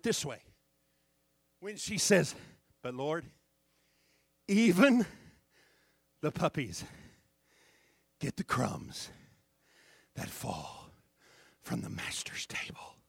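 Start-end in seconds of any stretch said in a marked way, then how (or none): 2.8-13.0 falling to a low whisper; hushed plea. Acted. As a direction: fade each phrase softer and lower. Woman's humble reply.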